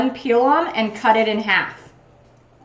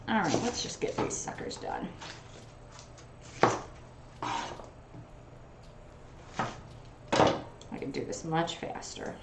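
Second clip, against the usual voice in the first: muttered to herself; 4 semitones lower, 15 dB quieter than usual. Real, not acted. Muttering to herself while chopping.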